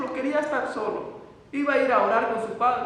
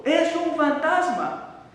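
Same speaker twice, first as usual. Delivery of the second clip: higher, slow frightened cry of a ghost sighting; 5 semitones higher, much slower than usual. Acted. Disciples on the boat cry out at Jesus on the water, frightened. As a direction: cry out in fear, drawn out. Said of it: "se asustaron y gritaron, llenos de miedo".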